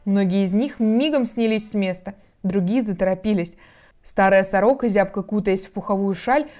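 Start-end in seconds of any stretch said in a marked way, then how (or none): none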